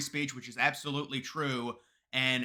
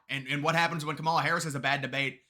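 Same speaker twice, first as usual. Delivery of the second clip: ordinary talk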